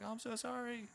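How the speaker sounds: high, soft mock Jersey-accent apology; attempted Jersey accent. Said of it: "I can't do a Jersey accent".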